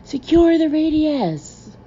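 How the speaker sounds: high, drawn-out battle cry; top-of-lungs yell. Acted. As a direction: yell it loud and high, stretching the words. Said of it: "yelled at the top of my lungs".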